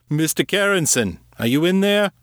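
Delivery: insistent call, pitch well up. A narrator's reading. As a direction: demand an answer through the door. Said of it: "more insistent".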